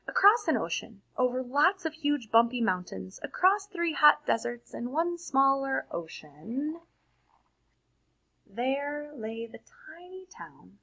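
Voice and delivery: put-on lively read-aloud for children; expressive storybook reading to a class; story narration. A narrator's reading. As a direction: read it like a bedtime picture book. Reads picture book aloud.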